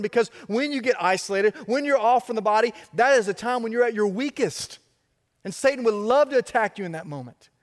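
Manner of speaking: rising sermon fervor; full-force preaching. Real, not acted. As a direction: drive it home to the congregation.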